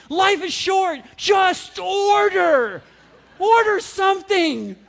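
loud, high shout, slow on just order; impatient shout. Acted. Frustrated father urging wife to order, loud shout. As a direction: bark it out at the indecisive orderer. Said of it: "venting impatience".

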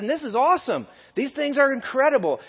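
imitated enthusiasm, pitch way up; animated. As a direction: gush as if thrilled by amazing news. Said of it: "[name] got a little animated".

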